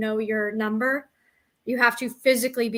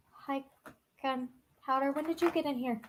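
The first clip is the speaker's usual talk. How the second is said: pet talk; 2 semitones higher, 9 dB quieter than usual. Real, not acted. Talking softly to her pet.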